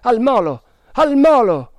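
loud, high shouted order; urgent command. A narrator's reading. Man ordering rowers to the dock, urgent shout.

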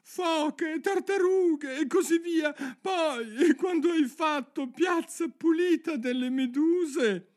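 very high creature voice; excited turtle. A narrator's reading. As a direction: call out the steps in a high-pitched squeal. Turtle excitedly calling out dance steps. (voce acutissima ed eccitata)

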